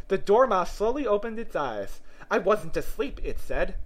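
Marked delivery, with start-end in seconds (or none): none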